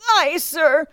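high, loud answer; recruit's reply. A narrator's reading. Recruit confirming to drill master, loud shout.

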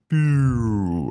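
long drawn-out falling "boooo"; imitated slow-motion whoosh. Everything on the plane going slow motion, imitated whoosh. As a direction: voice a sound effect sliding into slow motion.

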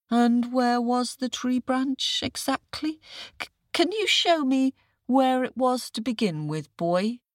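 high, dopey questioning jackal; puzzled jackal. Acted. Jackal playing dumb.

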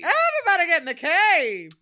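high, loud echoed yell; mock summons at full volume; playful calling out. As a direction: holler it like a silly rallying cry. Echoing the call into cave.

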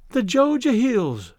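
"Georgia" slow, high and thrilling; "Georgia" drawn out as poem opening; recited verse title. A narrator's reading. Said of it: "in rather thrilling and more deeply pitched tones".

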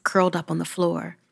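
usual speaking voice

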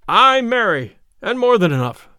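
high, loud admission of defeat; emphatic surrender. A narrator's reading. Beaten fighter answering the tanner, emphatic. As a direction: give in loudly and emphatically.

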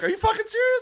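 very high-pitched mock disbelief; incredulous challenge. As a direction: retort like a comic confronting a heckler. Imitates comic confronting heckler.

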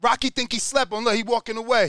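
usual speaking voice

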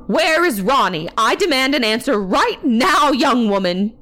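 high, forceful angry demand; crying out in demand. A narrator's reading. Aunt demands answers from worker, crying out. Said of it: "she cried".

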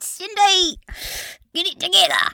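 high, loud snap at herself; loud self-rebuke, pitched high; self-scolding yell. Acted. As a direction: shout at yourself to get a grip.

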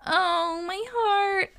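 high, drawn-out swoon; touched. Real, not acted. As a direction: melt into a high, tender coo. Touched by listener's love story.

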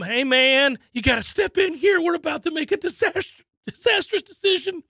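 pressing the owner to step in; mock panic building up; urgent plea. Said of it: "He's trying to tell him".

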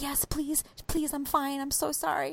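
soft, high, urgent whispery plea; hushed pleading. Retold or imitated. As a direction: imitate begging without waking a baby. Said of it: "really needed her baby to not wake up".

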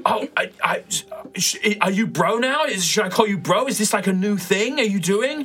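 high, bubbly bro voice; excited npc. Acted. Hyped friend quizzing Nova, high bubbly voice. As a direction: gush with eager questions.

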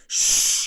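loud, urgent hushing hiss; sharp shush. Retold or imitated. Panicked daughter to her mother, shushing hard. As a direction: hush someone hard with a long shh.

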